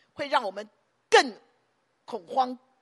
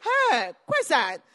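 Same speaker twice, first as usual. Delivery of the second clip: loud sharp command to Satan; Jesus rebuking Satan with a loud cry to get out; commanding rebuke; 3 semitones higher, 8 dB louder, much slower than usual. Retold or imitated. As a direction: bark it out to drive Satan away. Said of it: "耶稣说什么？".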